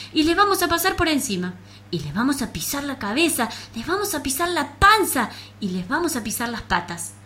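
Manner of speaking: fierce threat. Acted. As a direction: threaten like a brave little bug. Bug threatens cockroaches.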